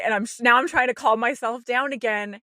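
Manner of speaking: ordinary delivery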